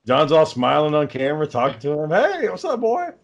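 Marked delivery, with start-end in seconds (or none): 2.1-3.1 high, taunting call to a thief; mocking taunt. John taunting a shoplifter on camera, high mocking voice. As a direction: tease him like you caught him stealing.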